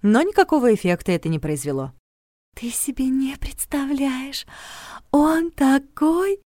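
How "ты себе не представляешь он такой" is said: high, slow whispered gush; breathy swoon. A narrator's reading. Regina swooning about her night.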